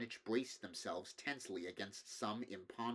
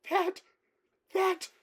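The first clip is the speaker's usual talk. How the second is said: shrieked out, far higher and louder; horrified shriek; 12 dB louder than usual. A narrator's reading. Curtis shrieking at the crowd about the monster. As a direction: scream it at the thing on the hill. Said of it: "with a piercing shriek".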